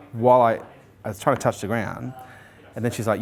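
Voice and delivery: ordinary delivery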